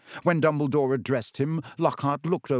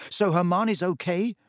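bright hopeful question, higher; brightly; 6 semitones higher than usual. A narrator's reading. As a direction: lift it with relief. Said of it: "said Ron brightly".

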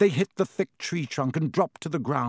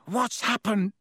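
gasped; 6 semitones higher than usual. A narrator's reading. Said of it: "Ron gasped".

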